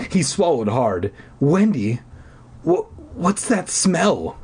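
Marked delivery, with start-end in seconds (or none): 1.4-4.4 stammering. A narrator's reading. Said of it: "he stammered".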